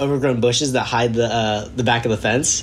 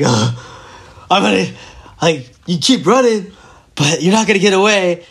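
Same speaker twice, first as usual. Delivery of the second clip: higher, halting mock out-of-breath threat; imitating winded cop; 4 semitones higher than usual. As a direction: puff it out in pieces, like an exhausted cop. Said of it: "like, winded".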